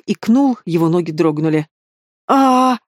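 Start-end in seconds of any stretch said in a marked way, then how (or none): 2.3-2.8 short loud high scream of terror; a frightened man screams as he sinks down; panicked scream. Acted. A terrified man screams at the demon, short and loud. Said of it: "закричал, оседая".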